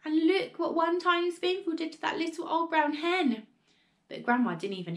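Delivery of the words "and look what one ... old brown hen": high, agitated lament; raised-pitch, fretful wailing; dismayed wail. A narrator's reading. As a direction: warn her in horrified disbelief. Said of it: "wailed Missus Cranky".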